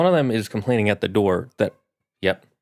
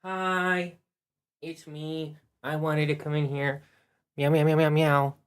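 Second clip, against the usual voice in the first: small, higher, softer pet voice; voice of cat Milk; 5 semitones higher, 6 dB quieter than usual. Pretend. Streamer speaking for the cat greeting viewers, cute cat voice. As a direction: speak for the kitty, softly, ending in meows. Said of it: "say hi, Milk".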